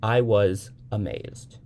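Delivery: ordinary delivery, nothing marked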